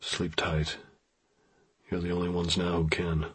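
very soft, hushed goodnight; whispered. A narrator's reading. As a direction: murmur so as not to wake them. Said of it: "he whispered to them".

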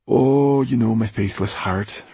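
breezy, falsely carefree reply; light-hearted. A narrator's reading. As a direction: toss it off with put-on carelessness. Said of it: "his light-hearted tone of voice".

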